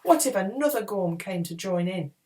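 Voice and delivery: usual speaking voice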